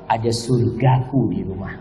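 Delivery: low, soft acted reply; tender contented husband. Husband telling friend about home, tenderly. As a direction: answer gently, dropping well down.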